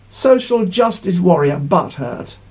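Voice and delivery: normal talking voice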